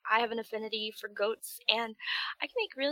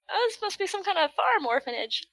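higher, louder wide-eyed player; wondering player; 8 semitones higher, 3 dB louder than usual. Retold or imitated. Player wondering about farm kids, mock puzzled. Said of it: "You're, you're like".

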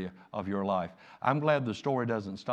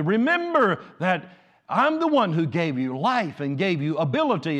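rising, louder sermon voice; preaching at full force; 9 semitones higher, 11 dB louder than usual. Acted. Pastor urging the congregation onward, preaching with rising force. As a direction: build the exhortation higher and higher.

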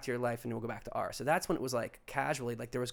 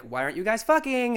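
demanding; 10 semitones higher, 7 dB louder than usual. Retold or imitated. Partner demands on return.

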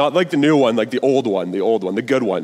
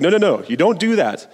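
pressure the cub with mock alarm; urgent Scar; 9 semitones higher than usual. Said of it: "and his uncle's like".

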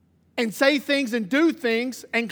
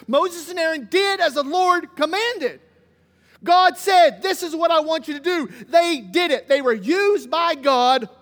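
preaching; 3 semitones higher, 5 dB louder, slower than usual. Acted. Preacher builds to full force.

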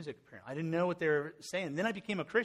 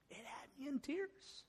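much higher and softer, near tears; choked-up repeat; 11 semitones higher, 12 dB quieter than usual. Real, not acted. Pastor reliving the video for the church, voice breaking softly. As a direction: let the emotion catch the repeat.

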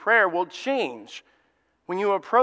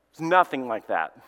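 ordinary talk

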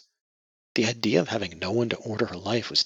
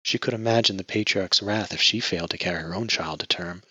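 ordinary delivery, nothing marked

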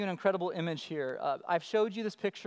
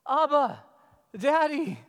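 higher, louder call; calling out to Father; 5 semitones higher, 6 dB louder than usual. Acted. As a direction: appeal to God as a child would. Acts believer calling on God.

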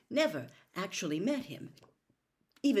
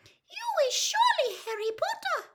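very high, louder elf squeak; Winky, awestruck; 5 dB louder than usual. A narrator's reading. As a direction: squeal in awe as a tiny house elf. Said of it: "looking awestruck".

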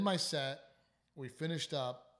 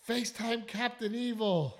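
mock woman calling out; 9 semitones higher, 7 dB louder than usual. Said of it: "so then a woman goes".

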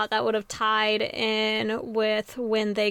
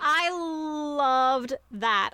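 long, drawn-out, higher-pitched delight; gushing; 5 semitones higher, much slower than usual. Real, not acted. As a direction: stretch it out with gushing love. Gushing over a favourite plot surprise.

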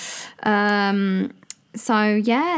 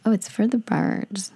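American accent; 3 semitones higher than usual. Shown as a demonstration. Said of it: "Do you know that one?".

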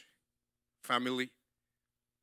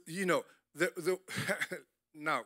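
ordinary delivery

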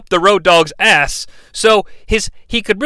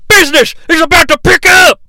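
much higher, loud shout; J R impression; 10 semitones higher, 5 dB louder than usual. Imitating J R hyping Stone Cold's entrance. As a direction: belt the catchphrase like an announcer. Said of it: "J R, let's hear it".

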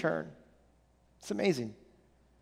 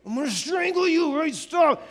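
high mock yell at his son; a father who's lost his patience, shouting stop; exasperated yell; 7 semitones higher, slower than usual. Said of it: "I just lose my patience".

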